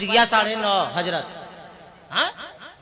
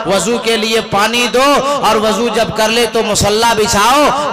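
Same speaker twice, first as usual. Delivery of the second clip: louder steady sermon list; preaching exhortation; 7 dB louder than usual. Acted. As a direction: lay out duties firmly.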